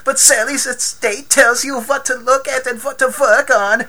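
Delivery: put-on high, loud German accent. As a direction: imitate the German dad's outrage. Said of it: "says it all in his German accent".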